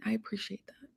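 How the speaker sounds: breathy thank-you whispered close. Real, not acted. Host whispering thanks to her viewers. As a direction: drop to a whisper to thank them.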